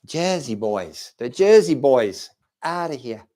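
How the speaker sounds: put-on punchy Jersey accent, falling at each end. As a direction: play a Jersey boy with the accent. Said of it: "How do they say it in Jersey?".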